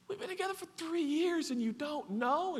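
high, soft disbelief; incredulous question. Acted. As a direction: ask it in pained, hushed amazement. Voices Jesus in disbelief.

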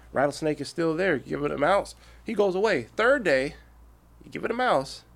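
normal talking voice

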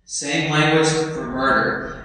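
ordinary talk, nothing marked

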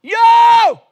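loud high shout; desperate cry. Retold or imitated. Beggar crying out to Jesus, loud high shout. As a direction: throw it out with everything you have. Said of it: "I cried out".

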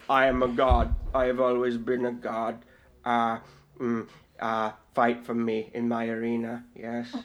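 low, lazy god-villain drone; megalomaniac rant. Retold or imitated. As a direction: drone it like a bored tyrant god. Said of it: "Darkseid is just".

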